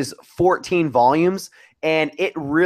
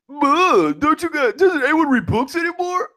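mocking imitation of Gabe; 13 semitones higher than usual. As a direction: mimic a friend's snobbery in an exaggerated high pitch. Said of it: "some comment to the effect of, like".

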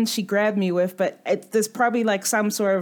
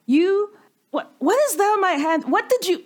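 disgusted surprise; 8 semitones higher than usual. Acted. Character disgusted at hand.

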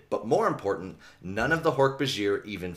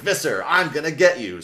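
loud, higher-pitched taunt; challenging; 6 semitones higher, 7 dB louder than usual. Retold or imitated. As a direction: call the villain out loudly. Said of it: "challenges Visser by being like".

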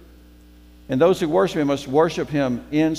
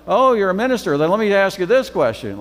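higher, louder eager pounce with a question; eager nosy passenger; 7 semitones higher, 6 dB louder, faster than usual. Retold or imitated. Airplane passenger learning he's a minister, eager and loud. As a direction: jump on the chance to quiz him.

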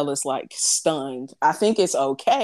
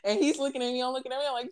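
normal talking voice

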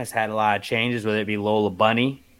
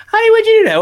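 very high, loud mock wife voice; exaggerated falsetto, calling to a husband; imagined wife; 10 dB louder, much faster than usual. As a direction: shrilly ham up a nagging spouse. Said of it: "his wife's like".

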